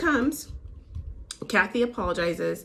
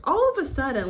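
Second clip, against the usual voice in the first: usual speaking voice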